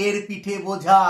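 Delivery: এর পিঠে বোঝা slowly chanted, last "jhaa" stretched; imitated popular name. Teacher quoting what everyone calls the letter, drawn-out imitation. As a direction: drag out বোঝা as children recite it. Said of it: "সবাই বলে".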